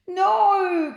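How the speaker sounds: very loud high cry; loud shrill cry; cried. A narrator's reading. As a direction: cry no desperately. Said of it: "cried Edmund".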